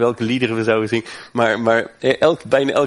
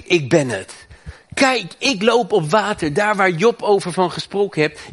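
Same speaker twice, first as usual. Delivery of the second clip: preaching at full force; 5 semitones higher than usual. Acted. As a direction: proclaim it to the congregation. Preacher proclaims Jesus' I AM. (vurig preken, hoog en luid)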